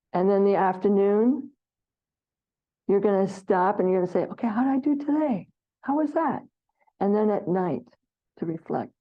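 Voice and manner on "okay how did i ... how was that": higher, quick curious question to yourself; light self-check. Pretend. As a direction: lightly quiz yourself at day's end.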